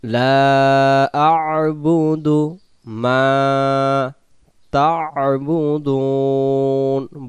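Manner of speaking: slow melodic tajweed chant; Quran recitation. Shown as a demonstration. Teacher reciting the verse for students, slow chant. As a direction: recite slowly, stretching each vowel.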